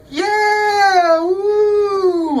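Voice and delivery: high, loud mock cheering yell; excited whoop. Imitating a fan in the front row cheering, high and loud. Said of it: "like that".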